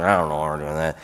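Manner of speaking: low, indistinct mumble under the breath; muttering. Shown as a demonstration. Employee unsure of the boss's directive, muttering. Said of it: "that's muttering".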